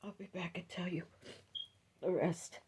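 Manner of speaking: soft, breathless whisper. Real, not acted. Telling her viewers she must stop, whispering. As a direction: barely get the words out. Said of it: "Please, excuse me".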